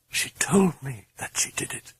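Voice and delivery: whispered, eerie and flat; whispered confession; hushed intoning. Acted. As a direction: breathe it out in dread. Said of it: "Chris intoned".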